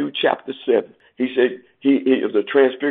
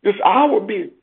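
impassioned preaching; 9 semitones higher than usual. Acted. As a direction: voice soaring much higher, urgent. Preaching climax on Jesus' suffering.

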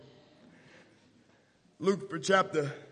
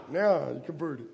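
ordinary talk

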